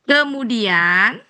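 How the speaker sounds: slow, phrase by phrase with long pauses; model translation reading. Shown as a demonstration. Teacher showing Bu Ella how to translate, slow and measured. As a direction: read it out slowly, stretching each word. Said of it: "Boleh gini ngartiinya".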